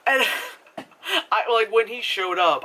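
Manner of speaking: ordinary delivery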